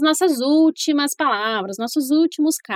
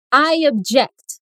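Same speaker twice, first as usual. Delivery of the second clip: slow demo repeat; 2 semitones lower than usual. Shown as a demonstration.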